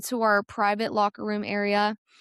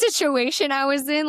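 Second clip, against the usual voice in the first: ordinary talk, nothing marked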